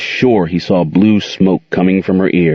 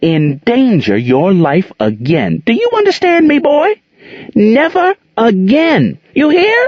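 poetic fury. A narrator's reading. Aunt Queen declaiming to Gerald, slow poetic fury. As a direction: declaim the threat like a verse.

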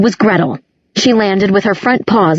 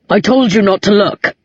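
lightly voiced growl of a half-wolf. A narrator's reading. Half-wolf Alaric blocks Clary's view, mild growl. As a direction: give the rebuke a touch of growl. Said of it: "Alaric growled".

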